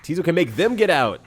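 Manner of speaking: normal talking voice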